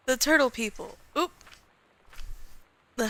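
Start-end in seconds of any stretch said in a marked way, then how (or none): none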